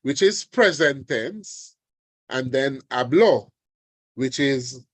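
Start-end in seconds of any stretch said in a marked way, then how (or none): 2.9-3.5 "hablo" as ha-BLO, final o stressed; last-syllable stress. Shown as a demonstration. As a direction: throw the weight onto the o of "hablo". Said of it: "the predicate".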